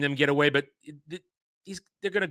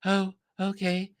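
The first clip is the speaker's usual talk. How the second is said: slow, softer, limp mock of the actor's line; imitating a limp delivery; 5 dB quieter than usual. Reviewer mocking Timothy Olyphant's weak line reading, slow and limp. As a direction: drag it out, feeble and unimpressed. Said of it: "he's just kind of talking like this".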